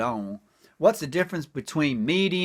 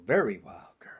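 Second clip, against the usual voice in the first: breathy whisper of the catchphrase; whispered refrain; 3 semitones lower, 4 dB quieter than usual. Acted. Comic whispering his refrain to the crowd. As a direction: hush it like a secret.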